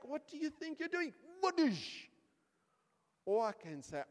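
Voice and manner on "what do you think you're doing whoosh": high-pitched indignant challenge into a punch noise; mock outrage. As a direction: act out confronting him, ending in a loud whoosh. Acts angry reaction to being spat on.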